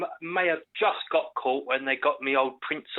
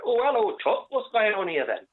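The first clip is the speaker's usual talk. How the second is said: cheery put-on voice; 7 semitones higher than usual. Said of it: "I'm like".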